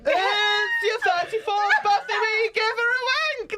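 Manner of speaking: laughing. Real, not acted. Slow teasing announcement, laughing.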